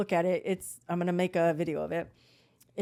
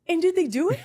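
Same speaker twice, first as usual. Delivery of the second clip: high, loud, excited asking; burning to hear the answer; eager acted question; 10 semitones higher, 8 dB louder than usual. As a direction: pounce on the question, reenacting excitement. Acts her eager question to him.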